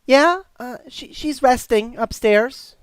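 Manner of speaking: put-on high, loud little-boy voice; helpful young boy. Boy answering stranger about Grandpa, young and helpful. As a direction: answer as an eager kid.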